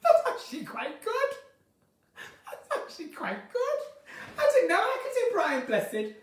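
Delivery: high, squeaky laughing self-praise; amazed laughter. Real, not acted. Delighted by own impression.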